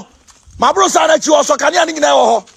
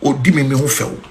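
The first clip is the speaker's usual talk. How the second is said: mock quote; 10 semitones lower, 5 dB quieter, faster than usual. Quoting someone in a lowered voice.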